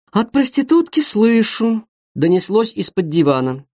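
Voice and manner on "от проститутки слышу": high, drawn-out comeback; slow high-voiced acted retort; indignant retort. A narrator's reading. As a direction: throw the insult back, pitched up and slow. Hidden woman retorts from under sofa.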